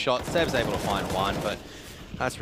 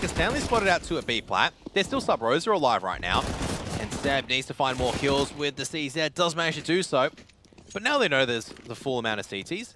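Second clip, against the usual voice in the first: raised, higher esports call; live play-by-play; 5 semitones higher, 4 dB louder than usual. Acted. Caster tells viewers Seb needs more kills, raised play-by-play. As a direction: call the action as it unfolds.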